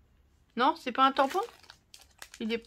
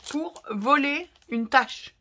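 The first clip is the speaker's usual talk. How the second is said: slow, weighted outrage on voler; indignant stress; 3 semitones higher, 4 dB louder, much slower than usual. Real, not acted. To viewers, mocking a thief who stole a splotch stamp. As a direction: spell it out, scandalised.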